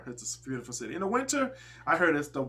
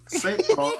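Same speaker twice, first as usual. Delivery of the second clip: a hint of a Minnesota accent, much higher on "Saint Paul"; 14 semitones higher than usual. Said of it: "I wonder how they say Saint Paul".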